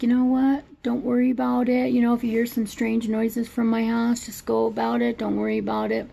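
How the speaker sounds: lightly voiced friendly neighbor reassuring.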